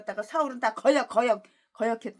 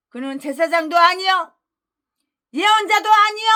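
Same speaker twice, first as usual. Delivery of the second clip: louder, high declaration; preaching declamation; 5 semitones higher, 6 dB louder than usual. Real, not acted. Preacher proclaiming Saul was neither priest nor prophet. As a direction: proclaim it to the whole hall.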